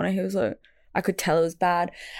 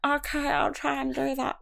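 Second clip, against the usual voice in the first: higher, muffled tonsillitis voice; swollen-throat voice; 6 semitones higher, 4 dB quieter than usual. Retold or imitated. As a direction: imitate talking with a badly swollen throat. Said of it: "that's how I was speaking".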